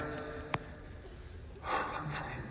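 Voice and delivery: long steadying breath, acted out; drawn-out re-enacted sigh; deep breath. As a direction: take a big breath to compose yourself. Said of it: "Deep breath".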